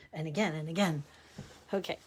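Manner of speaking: ordinary talk, nothing marked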